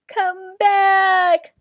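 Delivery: high, loud desperate shout. A narrator's reading. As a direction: shout after the flying cone. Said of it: "shouted Cyril".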